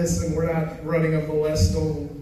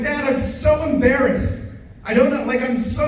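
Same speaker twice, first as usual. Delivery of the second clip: embarrassed daughter; 8 semitones higher than usual. Retold or imitated.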